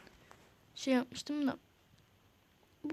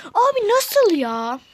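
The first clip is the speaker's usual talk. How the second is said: high, slow, drawn-out "how?!"; can't believe what just happened; stunned disbelief; 8 semitones higher, 8 dB louder, much slower than usual. Real, not acted. Shocked at game moment.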